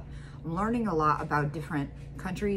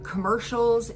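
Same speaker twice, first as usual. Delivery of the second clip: normal talking voice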